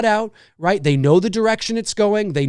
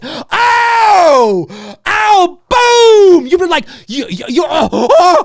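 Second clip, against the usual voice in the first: much higher, loud whoops and a booming cry; ecstatic fan shouts; 12 semitones higher, 9 dB louder, much slower than usual. Retold or imitated. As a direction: explode like a fan seeing the twist. Fan losing it at the cliffhanger.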